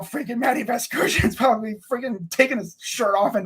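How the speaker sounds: normal talking voice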